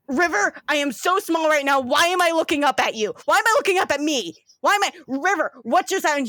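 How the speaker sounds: a brother lost in the wrong body. Acted. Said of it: "And he's just like".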